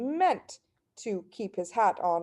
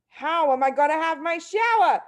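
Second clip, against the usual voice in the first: demanding; 7 semitones higher, 4 dB louder than usual. A narrator's reading. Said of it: "he demanded".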